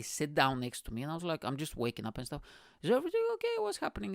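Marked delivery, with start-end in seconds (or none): 2.8-4.1 put-on high, startled child's voice, jumping way up; a scared little boy suddenly worried; squeaky panicked kid asking what's wrong; alarmed kid. Kid woken by worried parents.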